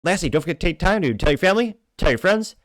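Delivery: rally the viewers like a host wrapping up; upbeat outro. Acted. Energetic channel outro appeal.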